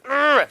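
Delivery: high, loud mock groan of disgust; disgusted ugh. Girlfriend recoiling as a creepy guy tries to lick her face, mock disgust. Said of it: "She's like".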